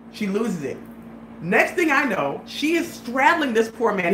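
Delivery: ordinary talk, nothing marked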